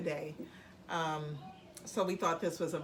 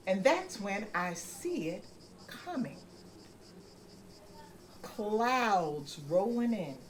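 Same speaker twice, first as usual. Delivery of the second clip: story narration; 3 semitones higher, 3 dB louder than usual. A narrator's reading. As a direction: read it unhurried for a child. Parent reads the story.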